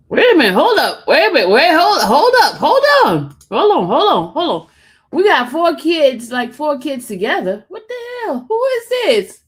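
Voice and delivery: high, shouted rapid-fire interruption; loud disbelief. Real, not acted. Host hearing of four kids together, loud disbelief. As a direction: halt everything, yelling in shocked disbelief.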